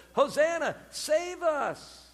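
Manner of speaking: high, drawn-out plea; crying out. Retold or imitated. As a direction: imitate a crowd's cry for rescue. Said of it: "they're crying it out to Jesus".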